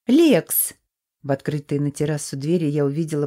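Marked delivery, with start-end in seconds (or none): 0.1-0.7 high, loud squeaky call; squeaky yell. A narrator's reading. Blonde shouting after the guard.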